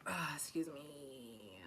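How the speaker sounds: hushed excuse me; soft breathy apology after a gasp; whispered apology. Real, not acted. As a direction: whisper it, embarrassed. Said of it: "that was awfully aggressive".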